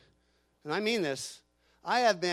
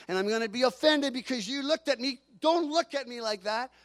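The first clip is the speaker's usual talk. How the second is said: higher, louder preaching build; impassioned preaching; 4 semitones higher, 4 dB louder than usual. Acted. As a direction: preach it out with rising fire. Preacher rising to a climax.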